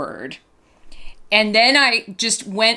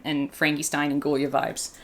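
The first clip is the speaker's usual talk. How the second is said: ordinary talk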